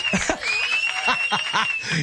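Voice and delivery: sudden high-pitched laugh; high laugh burst. Real, not acted. Host cracks up at lips joke.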